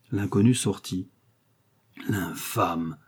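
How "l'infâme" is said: low whisper of outrage; whispered insult. A narrator's reading. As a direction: whisper the insult under your breath. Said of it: "dit madame Bonacieux, en adressant encore cette épithète". (insulte chuchotée, indignée)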